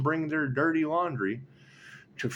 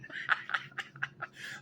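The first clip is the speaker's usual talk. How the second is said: soft, breath-only laughter; quiet laugh; 11 dB quieter than usual. Real, not acted. Reader amused by the Scotland punchline, quiet laugh. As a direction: laugh almost without voice.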